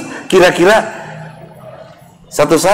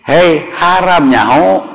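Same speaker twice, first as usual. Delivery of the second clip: slow, higher, blunt warning with no more sweetness; stern scolding; 6 semitones higher, slower than usual. Retold or imitated. Father warning his child a third time, slow and stern. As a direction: drop the endearment and bark the warning. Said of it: "Geus teu make sayang deui kan".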